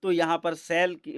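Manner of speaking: normal talking voice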